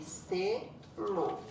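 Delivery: "Selo" divided as se, lo with a gap; split into syllables. Shown as a demonstration. Teacher showing how it was divided, "Selo" in two separate beats. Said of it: "Ficou dividida ali".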